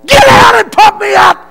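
loud, very high shouted order; a drill sergeant ordering a recruit to do push-ups; drill sergeant yelling. Retold or imitated. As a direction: scream the punishment.

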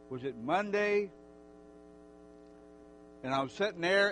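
ordinary talk

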